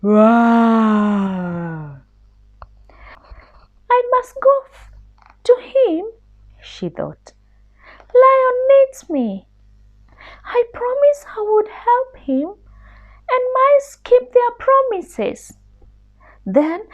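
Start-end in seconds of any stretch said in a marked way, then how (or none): 0.0-2.0 long, loud drawn-out roar; lion roar. Acted. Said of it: "heard the lion's roar". 3.9-6.1 much higher, small squeaky mouse; a tiny scared mouse begging pardon; little mouse voice. Acted. Mouse resolves. 8.1-15.6 much higher, small squeaky mouse; a tiny scared mouse begging pardon; little mouse voice. Acted. Mouse resolves.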